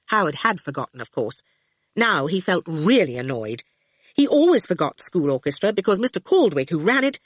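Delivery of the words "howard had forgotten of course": usual speaking voice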